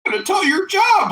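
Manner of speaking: high mocking tattle voice; mocking tattletale voice. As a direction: squeak it like a snitching kid. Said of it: "these people that are giving me a hard time".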